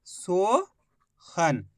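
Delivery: Sohan stretched very slowly; Sohan said very slowly as a final reveal; drawn out word. Shown as a demonstration. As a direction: draw out Sohan, every sound long. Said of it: "शब्द को अच्छी तरीके सुनो".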